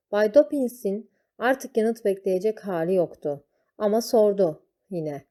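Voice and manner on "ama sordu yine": ordinary delivery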